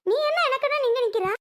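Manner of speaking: put-on high, agitated scolding over the baby's crying; angry yelling. Selvi scolds Soni to check the crying baby, yelling angrily. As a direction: raise the pitch and scold urgently. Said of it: "ஏன் கத்துற செல்வி?".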